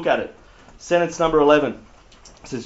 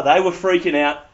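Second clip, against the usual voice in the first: ordinary talk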